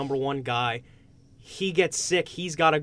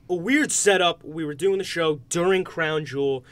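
ordinary delivery

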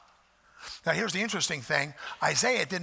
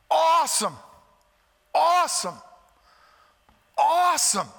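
preaching emphasis; 9 semitones higher, 5 dB louder than usual. Acted. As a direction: lean hard into each so far. Quoting angels' praise.

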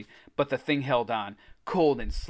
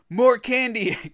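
high, loud, drawn-out plea; choked cry; 10 semitones higher, 7 dB louder, slower than usual. A narrator's reading. Greg squeezed by the blob, choked cry. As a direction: cry out as if being strangled. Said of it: "Greg choked".

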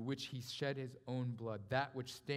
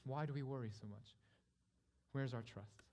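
ordinary delivery

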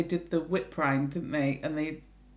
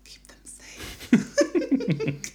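very slow laughing whisper; slow spooky whisper; 13 semitones higher, much slower than usual. Pretend. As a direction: drag it out in a hush.

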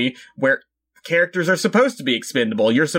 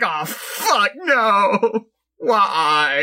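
1 high anguished cry; 7 semitones higher, much slower than usual. Retold or imitated. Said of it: "it's like".

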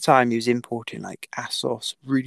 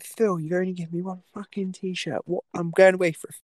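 higher, heated comeback to the boss; retold outburst; 6 semitones higher than usual. Rider snapping back at sponsor about one t-shirt, heated. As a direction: fire back at him in a raised voice. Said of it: "just lost my shit".

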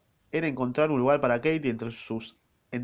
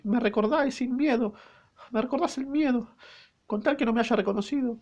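higher falsetto maid, fearful; frightened maid voice; 11 semitones higher than usual. Acted. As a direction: fret high about being recognized.

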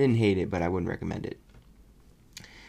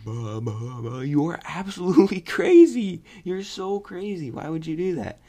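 much higher and louder, a mock blustering rant; exasperated outburst; 9 semitones higher, 7 dB louder than usual. Reviewer scolding the movie family, loud and exasperated. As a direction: splutter at them in disbelief. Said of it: "And I'm just like".

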